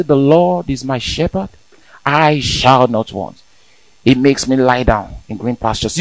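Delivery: quiet, slow reciting to yourself; soft self-talk psalm. Shown as a demonstration. Believer alone, speaking the psalm softly to himself. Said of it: "you just sit down there and say".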